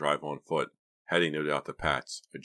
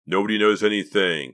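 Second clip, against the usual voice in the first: loud, raised-pitch flat-out denial; loud insistence; 5 semitones higher, 9 dB louder than usual. A narrator's reading. Boy trying to convince himself, loudly insistent. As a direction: insist forcefully, brooking no doubt. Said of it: "Clay insisted loudly".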